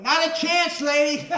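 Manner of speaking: loud, high heckling shout at a shopper; mock heckle. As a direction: yell a cheeky put-down at her. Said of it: "And I'm going".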